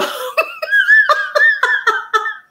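a long, very high squeal of laughter; long helpless squeal. Real, not acted. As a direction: dissolve into high giggles. Laughs at rabanito.

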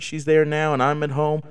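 ordinary delivery

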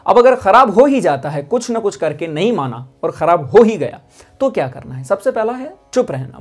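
ordinary delivery

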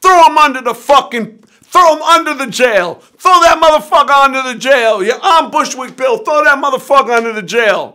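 high, loud furious rant imitating Bill; screaming. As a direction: scream abuse at your brother for the police to hear. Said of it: "he starts screaming on his brother".

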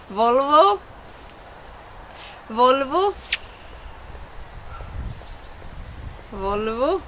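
Volvo pronounced the Swedish way, rising at the end. Shown as a demonstration. Teacher showing the car name Volvo, Swedish pronunciation. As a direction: model Volvo in Swedish, word on its own. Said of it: "words that I should, uh, pronounce".

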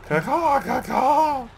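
high shrill crow caws; shrill screeching bird woman. Pretend. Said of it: "I'm gonna have to echolocate".

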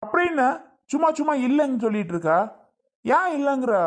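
high, fast, exasperated; husband snapping at wife who keeps denying, high and fast; exasperated outburst. Acted. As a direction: fire off the questions in frustration.

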